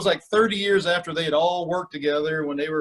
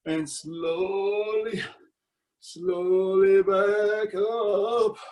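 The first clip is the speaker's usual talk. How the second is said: slow-motion coaching voice; super slow; much slower than usual. Shown as a demonstration. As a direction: stretch every word like the squat itself. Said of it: "super, super slow".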